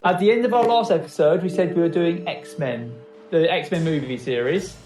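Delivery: normal talking voice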